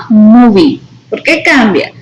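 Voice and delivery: ordinary talk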